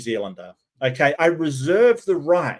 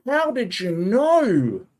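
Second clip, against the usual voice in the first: mock amazement; 6 semitones higher, slower than usual. Sarcastic amazed audience member.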